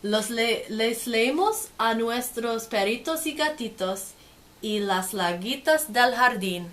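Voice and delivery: lively, sing-song storybook read; reading to children. Acted. Teacher reading a picture book to kids, lively and sing-song. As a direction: make the page sparkle for little listeners.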